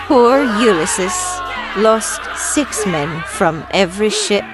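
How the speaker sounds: ordinary delivery, nothing marked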